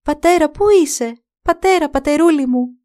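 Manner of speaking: high, loud desperate calls for father; calling out. Acted. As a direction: call out across the cliffs for her father. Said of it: "Άρχισε τότε να φωνάζει".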